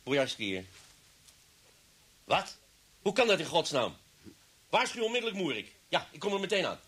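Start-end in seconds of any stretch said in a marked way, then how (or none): 2.2-6.8 jolted by bad news, snap out orders; alarmed on the phone. Inspector hears alarming news on the phone, shocked and urgent.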